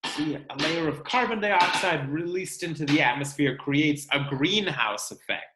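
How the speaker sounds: usual speaking voice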